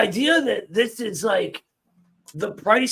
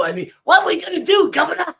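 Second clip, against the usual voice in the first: mock pleading; 8 semitones higher, 6 dB louder, much faster than usual. Mocking corporations pleading.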